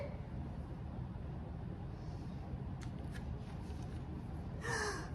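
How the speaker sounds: sharp breathless gasp of alarm; startled gasp. Acted. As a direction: gasp as if something landed on you. Shock at bird on head.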